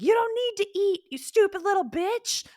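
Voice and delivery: high, mean sneer at the little one; harsh inner voice. Retold or imitated. Critic berating little Emily, high and harsh. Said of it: "be like".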